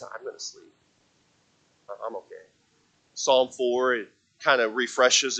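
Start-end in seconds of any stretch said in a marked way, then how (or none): 0.0-2.5 much softer and lower, settled; acted calm, barely voiced; quiet reassurance. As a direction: say it low and hushed, at peace. David at peace, going to sleep.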